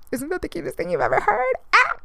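excited fan of cute puppy names; gushing excitement. Real, not acted. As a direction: squeal it out like you can't contain yourself.

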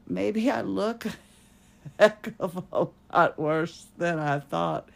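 real laugh breaking into the words; amused, self-mocking laugh while talking. Real, not acted. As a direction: laugh through it at your own expense. Laughing at her own condition.

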